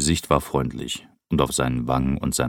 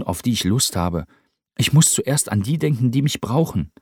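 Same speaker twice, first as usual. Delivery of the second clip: viel höher und lauter, eindringlich erklärend; jung, aber mit Gewicht in der Stimme; ernster Cameron; 12 semitones higher, 6 dB louder than usual. Acted. Cameron explains his burden. (Cameron erzählt Rupert von seiner Verantwortung)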